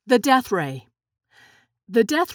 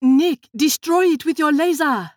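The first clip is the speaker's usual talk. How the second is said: urgent shouted command, pitched up; higher, louder cry to Nick; calling out; 5 semitones higher, 5 dB louder than usual. A narrator's reading. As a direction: call out an order across the room. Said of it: "Lily called out".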